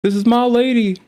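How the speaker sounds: high, mildly hammed-up yell; imitated scream at half volume; mock scream. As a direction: mimic a screaming man, pitched high. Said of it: "This man just screaming".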